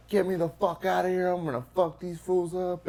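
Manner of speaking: high, furious jail-cell yell; raging yell. Retold or imitated. Banging on the cell door, yelling furiously at the guards. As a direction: rage at the guards through the door. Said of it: "I start banging on the fucking doors".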